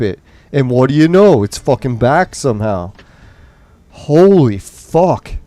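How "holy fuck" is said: very slow, higher disbelieving curse; drawn-out exasperation. Real, not acted. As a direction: stretch both words out in exasperation. Gamer exasperated at repeated glitch.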